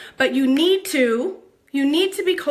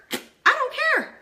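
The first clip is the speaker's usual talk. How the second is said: much higher sing-song don't care; sassy dismissal; 12 semitones higher than usual. Acted. Brushing off critics of her hair, high and sassy. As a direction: toss it off sky-high and sassy. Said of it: "sassy declaration".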